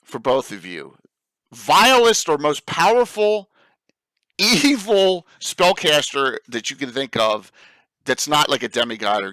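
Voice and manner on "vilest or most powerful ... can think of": dramatic. Acted. Poses dramatic first question.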